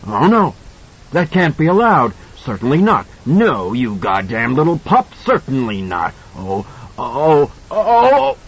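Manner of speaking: put-on trapped thing cursing the boy; panicking monster. A narrator's reading. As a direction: rage then wail in terror.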